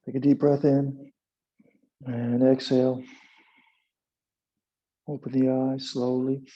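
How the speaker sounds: calm instructor calling poses; guided yoga cues. Acted. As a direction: guide the class through the hold. Leads yoga and meditation.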